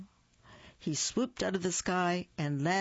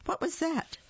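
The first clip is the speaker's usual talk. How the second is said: much higher, wondering lift; surprised; 12 semitones higher than usual. A narrator's reading. Narrator spots something shining below, surprised. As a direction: ask it in wide-eyed surprise.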